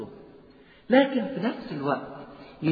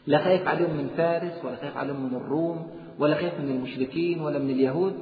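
ordinary delivery

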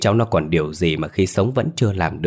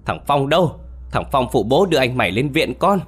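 higher, fast, frantic wail of a terrified father; alarmed, high-pitched calling for help; panicked cry; 7 semitones higher than usual. Acted. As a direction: cry out in panic for your son. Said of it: "hốt hoảng kêu lên".

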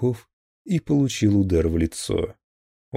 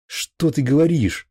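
higher and louder cry of protest; alarmed shout; 8 semitones higher, 5 dB louder than usual. Acted. Maslakov shouts at the captain over the phone call. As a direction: yell to stop him. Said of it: "закричал Маслаков".

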